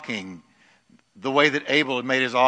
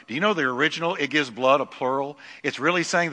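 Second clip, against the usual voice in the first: usual speaking voice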